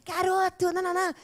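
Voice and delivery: mock-scold the boy caught in the act; scolding mother. Mother catching her boy on the sofa, scolding.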